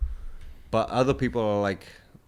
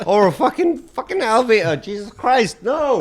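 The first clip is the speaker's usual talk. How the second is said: much higher and louder, half laughing; laughing outburst; 11 semitones higher, 11 dB louder than usual. Real, not acted. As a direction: blurt it out in laughing disbelief. Laughing outrage at name.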